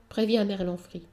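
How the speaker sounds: ordinary delivery, nothing marked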